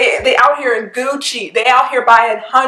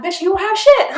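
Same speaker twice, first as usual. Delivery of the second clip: high scornful retort; sassy dismissal; 9 semitones higher than usual. Retold or imitated. Fan scoffing at broke bragging rappers, high and sassy. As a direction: call them out with attitude. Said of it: "and I'm like".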